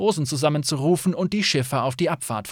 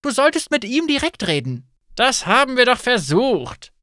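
higher, lightly voiced quarrel; arguing guests; 9 semitones higher, 4 dB louder than usual. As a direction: push back, mildly annoyed. Guests argue in inn.

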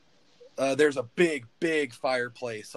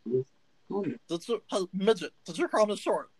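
lightly voiced gnome itching for a fight; scrappy gnome; 9 semitones higher, 13 dB louder than usual. Gnome Stitch challenges the taller man to fight, scrappy. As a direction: puff up like a tiny brawler.

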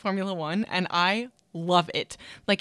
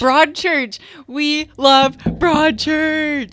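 excited; 8 semitones higher, 12 dB louder, much slower than usual. Real, not acted. Excited recognition of the show.

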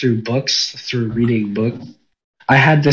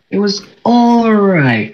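stretched, higher-pitched, slow "all right"; drawn-out all right; 6 semitones higher, slower than usual. Real, not acted. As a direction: draw out a so-so verdict. Drawn-out grudging concession.

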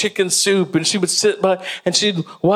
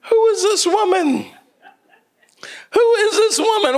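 high, amazed, almost crying out; mock disbelief. Daughter watching grandma spoil the girls, high astonished. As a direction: cry it out in comic astonishment. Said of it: "and I'm like".

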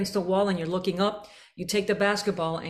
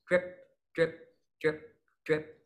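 short, light, detached drips; tiny separate drips; short drip sounds; 4 semitones lower, 5 dB quieter than usual. Shown as a demonstration. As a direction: drop each word like a drop of water. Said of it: "I like to think dip".

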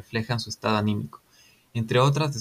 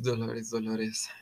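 whispering; 6 dB quieter, slower than usual. Real, not acted. Wistful sigh over the poet.

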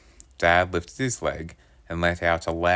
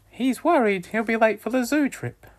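much higher gentle mum voice; mum's voice; 4 dB louder than usual. A narrator's reading. Mum explaining to dad why the son is up, much higher voice. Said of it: "Mum said".

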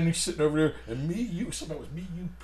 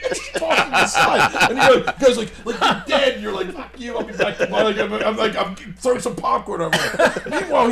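high, loud, words broken by laughter; giddy telling, laughing between words; laughing while telling; 8 semitones higher, 10 dB louder than usual. Real, not acted. Retelling Denny's story to friends through laughter.

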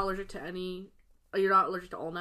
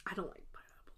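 whispering; 14 dB quieter than usual. Real, not acted. Muttered confession while tasting.

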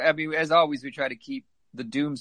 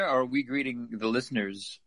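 normal talking voice

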